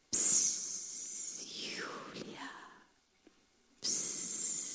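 barely audible hushed whisper; soft whisper. Shown as a demonstration. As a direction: whisper her name gently. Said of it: "wie ein leises Säuseln".

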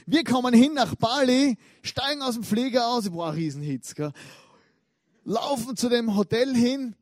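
rising storyteller excitement, bigger at the end; building excitement. Acted. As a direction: pump it up like a storyteller nearing the punchline. Building to the disappointing reveal.

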